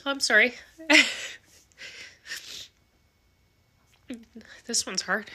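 real tears rising, long pauses between words; fighting back tears while speaking; tearful. Real, not acted. Telling viewers about a dying patient, tearful. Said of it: "sorry, I'm gonna cry".

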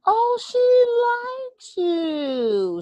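put-on very high, very slow, drawn-out sweet old lady; older Southern lady. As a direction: coo it high and slow like a doting Southern grandma. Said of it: "She was this older Southern lady".

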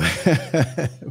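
quiet amused laugh; small falling chuckle; soft chuckle. Real, not acted. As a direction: laugh softly at the joke. Laughs at sugar water line.

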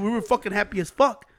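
usual speaking voice

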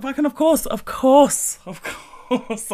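high, loud, mock-outraged repetition; exasperated. Retelling her reaction to burnt patches, exasperated. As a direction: throw it up high in comic despair.

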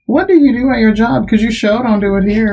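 high mock question at her husband; sarcastic jab. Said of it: "And I'm like".

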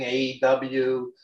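ordinary talk, nothing marked

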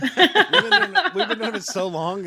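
pitch-shifted voice changer effect; voice mod. Real, not acted. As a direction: talk through a voice changer, slow. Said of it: "Voice mod's very distracting".

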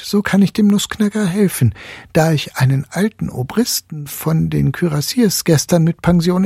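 put-on boyish voice. A narrator's reading. Fritz the boy speaking.

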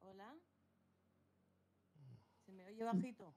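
barely audible, far from the mic; very faint audio. Real, not acted. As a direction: voice coming through very faintly. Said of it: "Se te oye, pero bajito".

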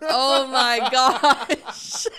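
loud, drawn-out laughing exclamation. Real, not acted. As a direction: laugh it out in disbelief. Stunned by movie choice.

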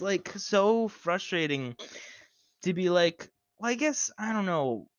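high loud exasperated rant; ranting in disbelief; frustrated rant. Real, not acted.

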